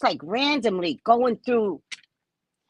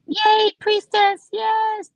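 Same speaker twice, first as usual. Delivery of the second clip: mock fan voice; 11 semitones higher, 5 dB louder, much slower than usual. Mocking a fan's greeting.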